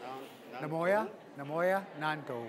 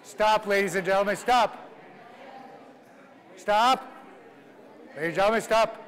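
loud, raised announcement to the room; loud call to attention; 4 semitones higher, 4 dB louder than usual. Shown as a demonstration. Teacher halting the language class, loud announcing call. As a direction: call the whole room to a halt.

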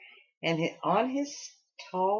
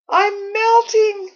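high, loud, slow wail; 12 dB louder, much slower than usual. A narrator's reading. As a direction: wail it out in despair. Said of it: "wailed a fat snow lady".

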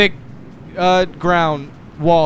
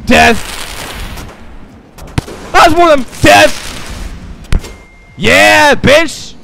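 battle cry; 9 semitones higher, 7 dB louder, much slower than usual. Real, not acted. Battle cry while killing.

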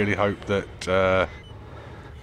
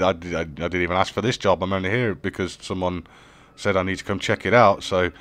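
ordinary talk

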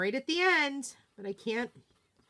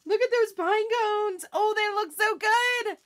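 excited; 10 semitones higher, 7 dB louder than usual. Real, not acted. Thrilled at the finished pine cones.

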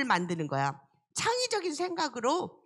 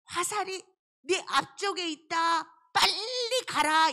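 higher, slow, drawn-out call; coded signal; 4 semitones higher, slower than usual. Pretend. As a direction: call out slowly so the hidden friend hears. Prince signals in code. (숨은 친구에게 천천히 외치는 신호)

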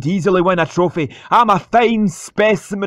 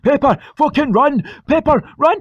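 urgent yelling; 6 semitones higher than usual. Acted. Urging during race.

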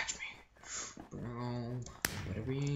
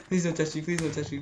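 usual speaking voice

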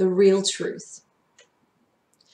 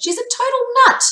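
much higher, fast, outraged insistence; indignant; 6 dB louder, faster than usual. A narrator's reading. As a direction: blurt it out at Mum. Kid insists to Mum.

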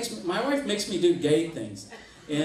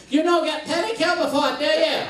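high, loud mock exotic accent; pitched-up broken-English accent for comic mimicry; exotic foreign accent; 10 semitones higher, 4 dB louder than usual. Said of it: "she had this real exotic accent".

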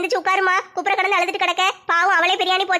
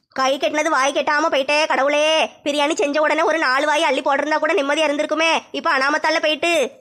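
put-on a much lower, grumbling older woman; lower, lamenting elder over lost food; lower character voice; 5 semitones lower than usual. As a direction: mutter the regret in a lower voice.

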